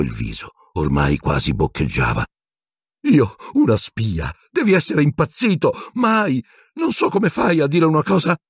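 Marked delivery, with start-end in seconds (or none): 3.1-8.4 high, frantic denial; panicked Pettigrew. A narrator's reading. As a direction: cry out that he never did it. (Pettigrew nega in preda al panico, voce alta)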